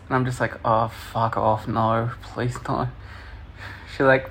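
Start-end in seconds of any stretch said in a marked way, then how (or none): 0.6-2.9 soft, slow, low-key pleading; dragged-out reluctant muttering; reluctant dread. Retold or imitated. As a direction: imitate a mortified groaning refusal, quietly. Retold dread of lap dance.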